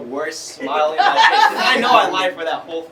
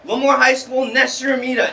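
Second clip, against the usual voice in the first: loud announcement; 8 semitones higher, 6 dB louder than usual. Retold or imitated. Announcing his own name loudly.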